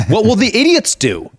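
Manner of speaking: ordinary talk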